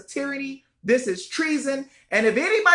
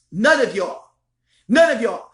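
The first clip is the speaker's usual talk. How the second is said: "None" punched harder and louder each time; emphatic louder repeat; 6 dB louder than usual. Real, not acted. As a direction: hammer "None of y'all" home, getting louder. Repeated emphatic line, building.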